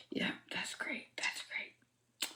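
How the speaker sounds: breathy whispered sarcasm, barely voiced; sarcastic whisper. Real, not acted. To the listener about his lost job, a sarcastic whisper. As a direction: mutter it under your breath, dripping sarcasm.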